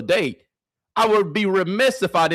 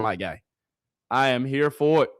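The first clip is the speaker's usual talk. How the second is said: ordinary delivery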